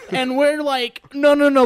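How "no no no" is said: loud, higher, panicky calming; hurried loud pleading; urgent calming. Retold or imitated. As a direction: rush to calm her down. They try calming Jill down.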